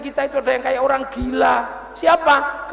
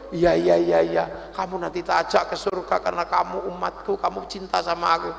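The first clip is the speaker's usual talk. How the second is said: much lower, softer soothing voice; gently calm a pleading follower; low, hushed and comforting; tender reassurance; 9 semitones lower, 5 dB quieter than usual. Acted. Voices the Prophet answering the man.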